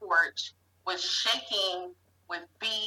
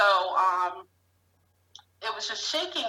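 ordinary talk, nothing marked

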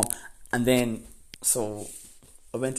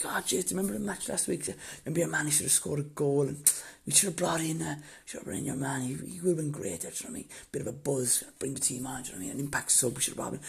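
softer, quick small talk; stalling chatter; 4 semitones higher, 6 dB quieter, faster than usual. Retold or imitated. Brother stalling the GAA lad, soft quick chatter. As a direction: ramble on to buy time.

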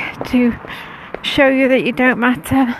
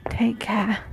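whispering; 7 dB quieter than usual. Real, not acted. Whispered goodbye.

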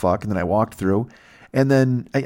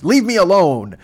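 whiny, louder and higher; petulant yell; 8 semitones higher, 6 dB louder, slower than usual. Retold or imitated. Rejected guy storms off to his lair, a petulant louder whine. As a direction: snap it like a sulking teen. Incel voiced, petulant shout.

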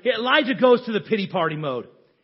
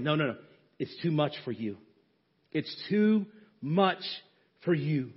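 gentle voice of God; 5 semitones lower, 7 dB quieter than usual. Acted. God gently speaks to weary listeners.

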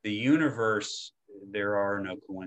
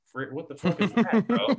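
higher, louder mock bafflement; baffled reaction; 10 semitones higher, 4 dB louder than usual. Someone reacting to an ontological coach's title, baffled. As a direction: blurt it out in comic disbelief.

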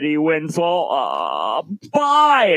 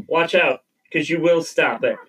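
usual speaking voice

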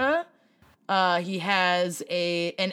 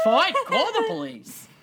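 higher-pitched, exaggerated New York-via-Boston retort; hammed-up Boston-New York accent; 7 semitones higher than usual. Host replaying the driver's retort, exaggerated Boston-sounding New York accent. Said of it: "a Boston accent".

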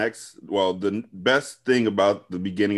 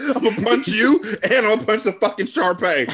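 mock threat; 9 semitones higher, 4 dB louder than usual. Mocking Will's threat.

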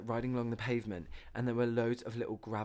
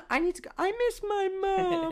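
put-on whiny child; 6 dB louder than usual. Friend mocks her wanting mum, childish whine. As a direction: wail it like a little kid.

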